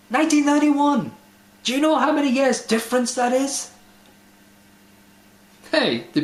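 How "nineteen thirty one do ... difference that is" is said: a far higher, louder burst of disbelief; astonished. Real, not acted. Vlogger stunned that the joke dates back to 1931, incredulous. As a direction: shout it out, stunned by the date. Amazed at how old the joke is.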